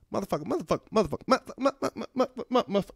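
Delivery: rising mock Samuel L. Jackson chant. Comic imitating the actor's catchphrase, rising chant. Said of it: "he said it in all his movies".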